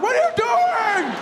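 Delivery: very high, drawn-out yell; stretched outraged screech; mock yell. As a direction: yell it in hammed-up disbelief. Repeats the onlookers' yell.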